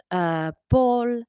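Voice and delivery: slow, halting announcement; slowly. Acted. Father tells the family of a death, slowly. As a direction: break the news very slowly. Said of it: "Larry said slowly".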